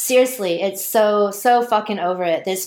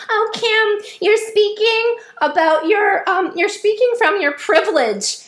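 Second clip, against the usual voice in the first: mocking whiny critic; 12 semitones higher, 3 dB louder than usual. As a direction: parody the preachy commenters in a high whine. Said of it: "they were like".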